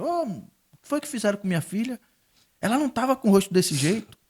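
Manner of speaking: high-pitched, pushed outraged question; indignant demand. Retold or imitated. Father confronting the nurse about his burned daughter, indignant. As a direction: demand answers from the nurse, upset.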